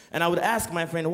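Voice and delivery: ordinary delivery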